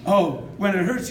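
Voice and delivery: ordinary talk, nothing marked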